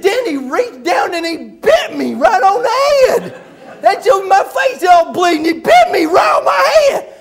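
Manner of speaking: high, loud mock wail of outrage; hammed-up worked-up howl, tongue-tied; Buddy. Buddy complains of being bitten, howling and worked up. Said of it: "when he gets real worked up".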